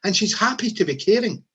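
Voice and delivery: usual speaking voice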